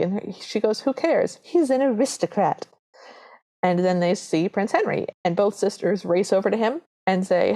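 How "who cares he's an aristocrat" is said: mock-impatient, higher-pitched scolding; dismissive stepmother. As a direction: wave off the objection as a pushy mother. Stepmother overrules daughters' objection.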